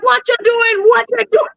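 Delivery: high-pitched, repeated startled challenge; retold alarmed demand. Acted startled confrontation with the man.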